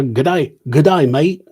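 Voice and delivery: loud, exaggerated Australian greeting; bad Australian accent. Shown as a demonstration. Admits he can't do Australian, bad Australian accent. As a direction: attempt a broad Australian accent, badly. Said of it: "I can't do an Australian accent".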